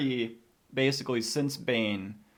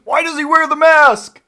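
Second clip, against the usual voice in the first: loud high mock yell at Bane; yelling impression; 16 dB louder than usual. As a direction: bellow it like the Bane scene. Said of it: "yelling at Bane".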